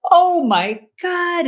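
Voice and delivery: high, slow, breathless "Oh my God"; stunned, drawn-out cry of wonder; overwhelmed awe. Retold or imitated. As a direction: let the revelation hit you all at once. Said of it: "And I was like".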